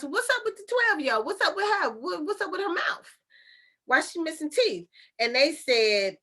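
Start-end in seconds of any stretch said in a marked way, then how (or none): 0.0-4.9 much higher, insistent questioning; questioning neighbor. Retold or imitated. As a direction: imitate a neighbor pressing for answers in a raised pitch. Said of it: "the neighbor was asking the parents".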